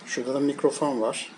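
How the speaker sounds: usual speaking voice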